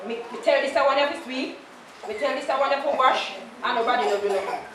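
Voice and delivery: quick exasperated patois grumble; hurried imitation of a fed-up mother; fast mock complaint. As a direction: rush through Mama's complaint. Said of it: "she would say".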